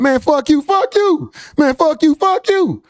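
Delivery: high-pitched mock yelling back and forth; hammed-up cousin quarrel; mock family argument. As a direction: play both sides of a silly cousin spat. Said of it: "Be like".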